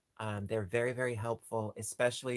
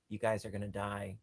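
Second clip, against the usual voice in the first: ordinary delivery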